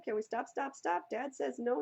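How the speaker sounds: ordinary talk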